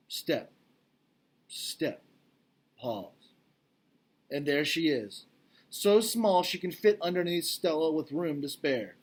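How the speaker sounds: ordinary delivery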